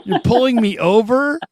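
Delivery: high, indignant disbelief; a dad scolding the fans for pulling him over; incredulous dad. Retold or imitated. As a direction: protest like a dad with kids in the car. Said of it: "I'm like".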